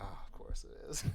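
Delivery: whispered, retold mutter to oneself; resigned aside. Host retells reacting to the audiobook, resigned whisper. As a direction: say it under your breath, all whisper. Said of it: "I'm like".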